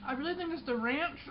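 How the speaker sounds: talking through a mouthful; mouth full. Real, not acted. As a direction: say it while chewing. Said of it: "I'm trying not to talk with my mouth full".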